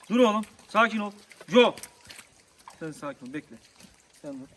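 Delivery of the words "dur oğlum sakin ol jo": firm call. Real, not acted. Calming excited dogs loudly.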